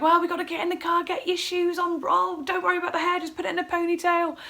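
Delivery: high, rushed orders; re-enacted flustered rushing; frantic. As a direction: hurry someone out, fast and high. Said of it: "this crazed mess at this point".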